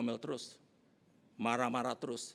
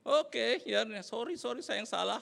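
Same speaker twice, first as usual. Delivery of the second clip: high, placating mock apology; apologetic backing down; 7 semitones higher than usual. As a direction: back off with a raised, conciliatory voice. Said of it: "terus langsung saya gini". (minta maaf mengalah, nada tinggi)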